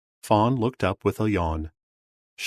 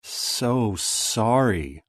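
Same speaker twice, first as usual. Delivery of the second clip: snake voice; much slower than usual. A narrator's reading. Snake speaks, she hisses.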